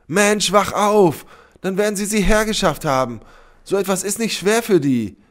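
very high, loud and urgent; exasperated shout. A narrator's reading. Sybil warns Sepp about Lena, shouting exasperated. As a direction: shake him awake with your voice. Shouts to make him understand.

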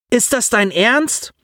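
changed voice. A narrator's reading. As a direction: stretch it out, can't believe it. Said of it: "Auch Collins Stimme änderte die Frequenz".

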